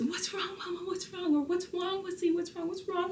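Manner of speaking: put-on very high little-girl voice asking what's wrong; lightly hammed-up baby daughter voice; toddler voice. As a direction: imitate a worried toddler, high and sweet. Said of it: "She's just".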